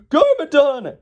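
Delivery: high, loud cry of alarm; hammed-up high shout; citizen's cry. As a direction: yell the villain's name from the street. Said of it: "the same guy at the same hot dog stand".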